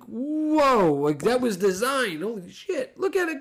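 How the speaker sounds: whoa, up high, laughing in disbelief; excited amazement. Retold or imitated. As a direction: relive the thrill of the play. Amazed at designed QB draw.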